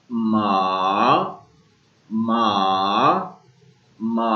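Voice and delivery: मा low, dipping down then back up; dipping third tone. Shown as a demonstration. Teacher modeling the falling-rising tone. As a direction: dip मा low and let it climb. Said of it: "up to down और फिर down to up की तरफ जाएगा".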